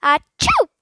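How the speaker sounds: loud mock sneeze; parrot sneeze. A narrator's reading. Parrot faking a sneeze at Philip, loud. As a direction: sneeze like a bird. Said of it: "a most realistic sneeze".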